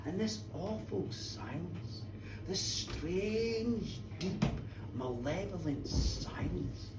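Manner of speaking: hushed, slow, ominous narration; soft, lingering dread; eerie storytelling. A narrator's reading. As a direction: let each word hang in the silence. Storyteller evokes eerie silence.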